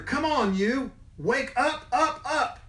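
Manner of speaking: high urging yell; coaxing yell. Acted. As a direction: nag the leaf awake. Yelling at another leaf.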